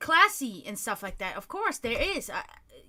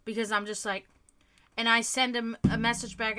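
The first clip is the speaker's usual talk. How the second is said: usual speaking voice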